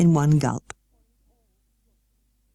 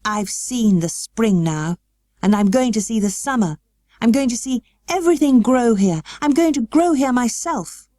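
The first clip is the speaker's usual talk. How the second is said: growing excitement; 6 semitones higher than usual. A narrator's reading. Colin telling Mary about seeing everything grow, building eagerly. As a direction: build from hushed wonder to eager. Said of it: "he answered".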